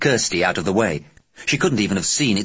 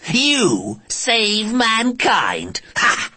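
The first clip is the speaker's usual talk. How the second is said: slow, high, mocking alien voice; mock him slowly like a cruel alien; scornful alien; 8 semitones higher, slower than usual. Alien taunts Johnny.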